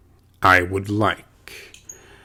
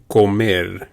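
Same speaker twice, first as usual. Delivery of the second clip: ordinary talk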